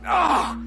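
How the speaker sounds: sharp loud cry; pained cry. Acted. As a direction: cry out as the blow lands. Cry in a sword fight.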